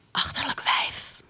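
whispered insult under the breath; spiteful whisper. Retold or imitated. Girl whispering a cruel insult at a classmate in gym class, spiteful whisper. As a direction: hiss it so only she hears. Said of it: "fluister ik haar toe".

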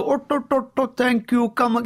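high, exaggerated Indian accent. Mocking the stereotyped accent bit.

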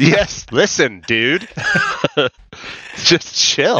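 laid-back dude voice, laughing; frat bro. Retold or imitated. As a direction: talk like a chill frat guy. Mock bro talk to board.

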